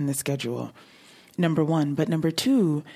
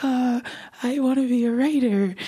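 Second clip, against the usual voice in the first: nervous stutter; 9 semitones higher, 3 dB louder than usual. Retold or imitated. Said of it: "with a full-on stutter".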